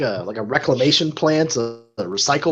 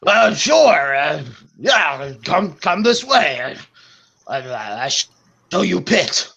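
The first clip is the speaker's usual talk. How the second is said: slow, broken-English brute; lumbering thug guiding the way; dim brute voice; 3 semitones higher, slower than usual. Acted. Brute guard leads them.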